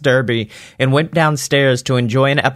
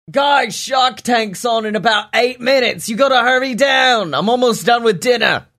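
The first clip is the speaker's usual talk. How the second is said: Pa Stinker calling; 10 semitones higher than usual. A narrator's reading. Pa calls the boys down for Shark Tank, shouting. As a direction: shout up the stairs, much higher. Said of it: "The voice of Pa Stinker calls from downstairs".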